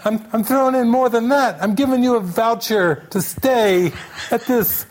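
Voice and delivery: high-pitched, amused imitation of the clerk; mock over-generous outburst; emphatic retelling. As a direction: declare it up high, with laughter creeping in. Fed-up clerk piling on upgrades.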